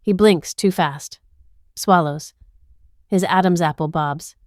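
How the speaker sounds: ordinary delivery, nothing marked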